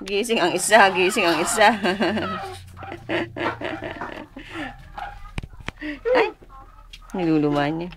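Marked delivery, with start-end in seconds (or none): 1.8-6.1 long, high-pitched giggling; amused laughter. Real, not acted. Relative amused that one baby woke, laughing. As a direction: giggle on and on, higher than your speech.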